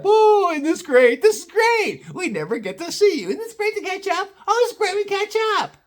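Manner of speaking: put-on high gushing old-folks voice; high sing-song delight; gushing grandparent. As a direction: gush over the grandkids. Grandparents gushing over kids.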